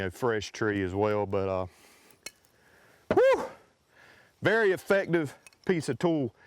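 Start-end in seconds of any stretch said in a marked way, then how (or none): 3.1-3.5 winded whew. Real, not acted. Winded after walking back.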